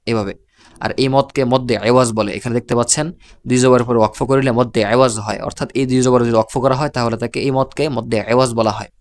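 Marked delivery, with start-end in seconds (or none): none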